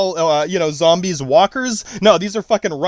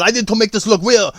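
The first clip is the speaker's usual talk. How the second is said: higher-pitched caricature of a movie hopeful; mock Chinese guy; 4 semitones higher than usual. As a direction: put on a higher, comic character voice. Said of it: "Some fat Chinese guy rolls in".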